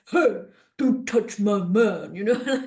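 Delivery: lightly voiced bouncer, slow; a hint of a protective bodyguard. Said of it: "He's like".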